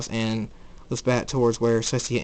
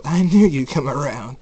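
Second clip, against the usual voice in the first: loud, lifted villainous delight; gleeful; 3 semitones higher, 7 dB louder than usual. A narrator's reading. Saturday welcomes the newly turned Jacob, gleeful. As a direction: crow it with mild glee, louder at the start. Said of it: "Saturday laughs and says".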